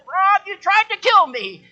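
put-on high, slow, outraged old-lady voice; indignant old lady. Preacher voicing Tony accusing Rob after baptism, high and slow. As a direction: accuse him slowly like a shocked grandma.

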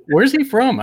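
sudden high, loud question, amazed; surprised. Real, not acted. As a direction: jump up in pitch in disbelief. Guest surprised by host's accent.

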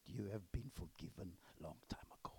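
low hushed assurance; whispered forgiveness. Retold or imitated. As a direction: whisper it gently.

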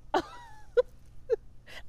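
a sudden, high-pitched burst of laughter. Real, not acted. Laughs at her own comeback.

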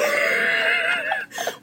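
shrieking laugh. Real, not acted. Cracking up while ranting to a friend, shrieking laugh. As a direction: throw your head back into a high shrieking laugh. Laughing hard mid-rant.

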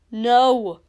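loud, firm contradiction; emphatic refusal; forceful denial. Acted. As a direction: shut it down hard. Hero flatly contradicts in quarrel.

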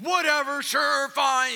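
high, loud, fed-up brush-off; dismissive farmer. Retold or imitated. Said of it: "the young farmer's like".

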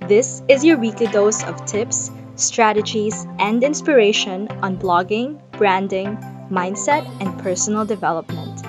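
upbeat announcer read over music; podcast intro. Acted. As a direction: sell the show like a radio host.

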